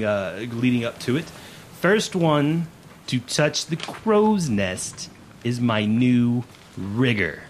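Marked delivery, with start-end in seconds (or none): 1.8-7.4 slow, projected officer's challenge; commanding first mate. Acted. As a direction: announce the contest to the crew. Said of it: "rubbing his forehead as he goes".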